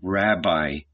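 low, hesitant address; small, uncertain reply; unsure answer. A narrator's reading. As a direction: venture the word timidly, dropped in pitch. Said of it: "Peter answered in an unsure voice".

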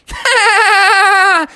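very high, loud rapid cackle; stuttering cartoon giggle up high; SpongeBob laugh. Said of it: "and he said".

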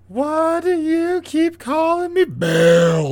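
high, drawn-out mock wail; slow, hammed-up plaintive cry; whining quote impression. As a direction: wail the question in an exaggerated cartoon voice. Acts out favorite show quote.

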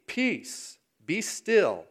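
high, firm command to the sea; authoritative rebuke. Acted. Jesus commanding the storm in scripture.

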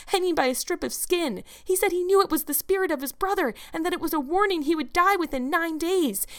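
put-on high, excited girlish storytelling; eager insistence. A narrator's reading. Anne tries to convince Marilla of ghosts, excitedly.

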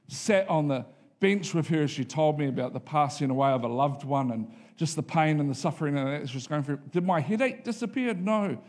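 ordinary talk

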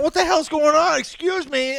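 loud, high-pitched protest; comically loud demand for an explanation; mock indignation. Bewildered diner objecting to the steak thief, mock indignation. Acted indignant reaction at table.

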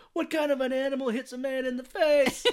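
high-pitched comic outrage; hammed-up high indignation; indignant victim, imitated. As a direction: squeal it like an affronted man on the ground. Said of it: "this guy on the ground is so funny".

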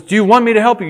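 high, loud calling question; the voice of God calling down; voice from above. Acted. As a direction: call the offer down to him. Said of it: "And the voice says".

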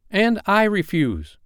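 put-on very high little-boy voice, slowed; defiant cry. Michael backing John against Hook, high defiant cry. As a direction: echo the refusal in a piping cry. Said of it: "cried Michael".

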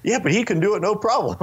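high-pitched imitated rebuttal; mock defensive reply; retold protest. Retold boyfriend arguing back to dad.

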